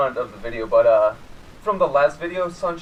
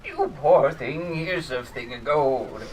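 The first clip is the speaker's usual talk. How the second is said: put-on old woman voice; slower than usual. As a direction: croon it like an old lady. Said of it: "some old wench came up to me and she was like".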